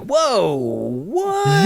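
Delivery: high, drawn-out disbelief; mock shock. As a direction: play stunned by a sudden flip. Acting out the mood flipping abruptly.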